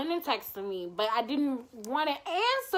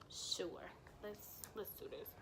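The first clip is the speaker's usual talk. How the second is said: whispered agreement; 3 semitones lower, 19 dB quieter than usual. Retold or imitated. Retold hesitant agreement.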